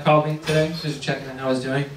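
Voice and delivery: ordinary talk, nothing marked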